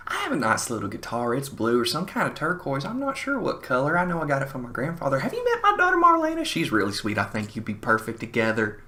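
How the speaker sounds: high, rapid run-on gush; in one breath. Retold or imitated. Older woman pitching her daughter to the drummer, one-breath gush. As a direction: rattle it off without a breath, pitch climbing. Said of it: "she chimes in with one breath".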